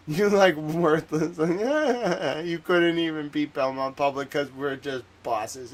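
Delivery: loud laughing mockery; laughing taunt. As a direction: laugh at them while you insult. Mocking losers with laughs.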